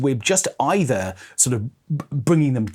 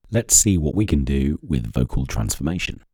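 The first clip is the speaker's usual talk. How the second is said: pitched-down processed voice; pitch shifted voice; 9 semitones lower than usual. Shown as a demonstration. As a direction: voice shifted much lower. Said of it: "that's pitch change".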